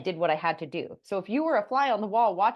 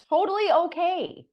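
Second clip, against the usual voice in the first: higher, louder and slow, sing-song totally okay; breezy brush-off; 9 semitones higher, 5 dB louder, slower than usual. Real, not acted. Telling listeners the client never answered, a breezy brush-off. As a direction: wave it off with exaggerated cheer.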